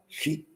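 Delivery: "She" said softly, without stress; unstressed SHE. Shown as a demonstration. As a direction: leave "She" light and soft. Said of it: "I don't stress it".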